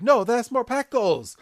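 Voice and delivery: high-pitched mock protest; [name]. Podcaster voicing a villain guarding toys, high protest. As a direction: object in a high cartoon voice.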